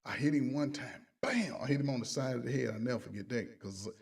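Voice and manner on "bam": a quick high "bam!" for the blow landing; imitated punch noise. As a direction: punch the sound out like a cartoon hit.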